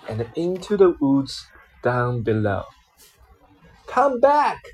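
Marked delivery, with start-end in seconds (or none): 3.9-4.6 a high call after someone running off; calling after her. A narrator's reading. Spider calls after the fleeing girl, in a high call. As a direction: call out as she flees. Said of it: "called the spider".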